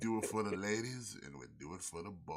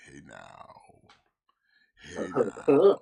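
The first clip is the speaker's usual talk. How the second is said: sexy voice; 2 semitones lower, 20 dB louder, slower than usual. Pretend. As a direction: purr it like a seducer. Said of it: "talking in a sexy voice".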